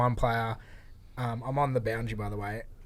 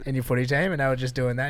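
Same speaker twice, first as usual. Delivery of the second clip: ordinary talk, nothing marked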